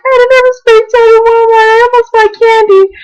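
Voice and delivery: high, squeaky panicked voice; panicked high squeal. Retold or imitated. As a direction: squeal it like a desperate confession. Panicked call to friend Diana.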